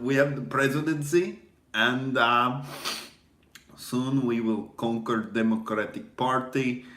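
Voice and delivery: mock triumphant Russian agent; Russian accent. Fake defector boasting about conquering the party, Russian accent. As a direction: gloat in a put-on Russian accent. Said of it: "this is my fake accent".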